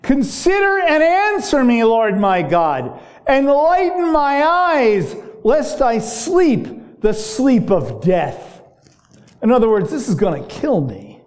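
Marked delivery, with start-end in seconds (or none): 0.0-8.6 higher, slow, pleading psalm reading; slow, lifted lament read from the pulpit; impassioned scripture reading. Acted. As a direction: pray the verse as a desperate plea. Reads psalm as David's cry.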